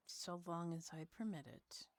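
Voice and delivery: soft whispered condition; ominous whisper. Acted. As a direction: hush the terms like a threat. Character grants entry ominously.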